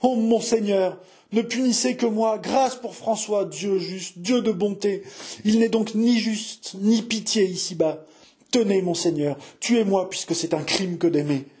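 high, anguished supplication; desperate pleading. Acted. Jeanne begging monseigneur to punish only her, desperate pleading. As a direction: beg for mercy with a breaking heart. Jeanne begs mercy for François.

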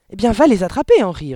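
loud, high, insistent order; urgent prodding. A narrator's reading. Mother sending her husband after burglars, urgent insistence. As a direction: push him into action.

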